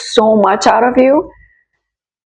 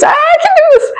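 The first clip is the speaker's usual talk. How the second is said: suddenly much higher and louder, strained and on the edge; overwhelmed squeak; 4 dB louder than usual. Retold or imitated. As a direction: squeak it out as your nerve breaks. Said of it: "I was like".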